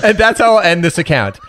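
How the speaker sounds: high, laughing through the line. Real, not acted. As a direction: giggle while retelling.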